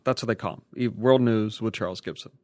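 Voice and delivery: ordinary talk, nothing marked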